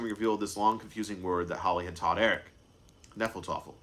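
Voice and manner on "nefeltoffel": usual speaking voice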